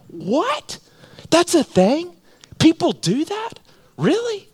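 high-pitched incredulous questions; imagined disbelief. Retold or imitated. Preacher voices a newcomer shocked by giving, mock incredulous. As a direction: lift each short question sky-high, mimicking astonishment. Voices astonished first-time visitor.

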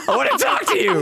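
a squealed, hammed-up plea through the wall; high, silly voice mocking the vlogger; mock Ash.

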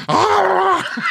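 a loud, high triumphant shout; high release yell. Retold or imitated. Teen after winning first fight, high mock yell. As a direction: let out a pent-up roar, pitched high.

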